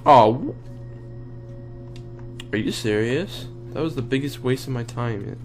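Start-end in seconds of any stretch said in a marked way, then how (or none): none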